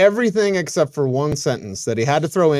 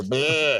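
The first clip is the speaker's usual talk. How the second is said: short bleating fawn cry; baby deer bleat. Shown as a demonstration. Host mimicking baby deer calling mother, bleat. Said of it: "a baby deer calling to its mother".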